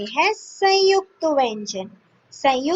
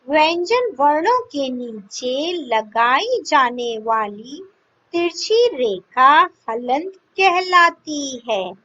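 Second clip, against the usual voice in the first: ordinary talk, nothing marked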